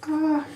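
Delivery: short high wail of dread; high anxious yelp. Retold or imitated. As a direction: squeal at the thought. Knowing catheter coming.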